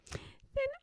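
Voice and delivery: lightly piggy and pert; little pig voice. Acted. Pigwig offers to sing for Pigling, high and pert.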